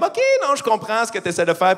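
high knowing "ok", then easing down; intelligent spectators. Acted. Clever spectators getting the sarcastic joke, knowing put-on voice. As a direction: play the listener who gets the irony. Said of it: "les gens intelligents dans la salle étaient comme".

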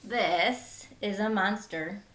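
ordinary delivery

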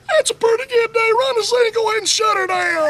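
high squeaky boss giving orders; boss's high-pitched voice. Retold or imitated. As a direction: bark it high like a wheezy boss. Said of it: "Had a real high-pitched voice".

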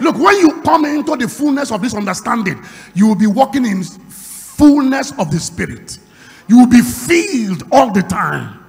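loud, fervent preacher calling to the church; a pastor firing up his congregation, loud and fervent; preaching at full force. Acted.

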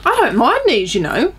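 talking with food in mouth; snacking on pretzel stick; chewing while talking. Real, not acted. Seen 2: Holds bitten pretzel stick, mouth working between bites during words.

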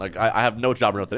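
normal talking voice

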